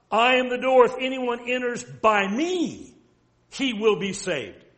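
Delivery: loud, emphatic scripture quote, easing on "he will be saved"; emphatic declaration. Retold or imitated. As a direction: declare it emphatically and directly, stretching ME. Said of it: "That's very emphatic and very direct".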